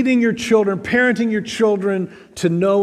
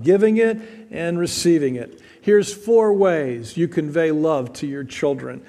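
usual speaking voice

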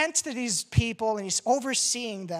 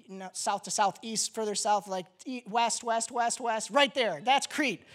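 normal talking voice

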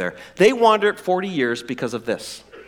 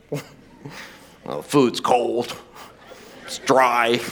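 complaining relative; 6 semitones lower, much slower than usual. Acted. Voicing complaining family member.

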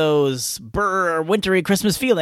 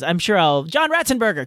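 ordinary delivery

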